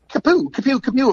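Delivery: high-pitched "kapoo" sound effect; rapid-fire zips, like things flying away; imitated zooming noise. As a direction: voice a quick high whooshing pop. Imitating calls flying off the website.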